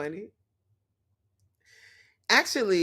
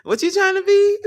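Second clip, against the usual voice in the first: laughing; 4 dB louder than usual. Real, not acted. Mocking the movie's poem line.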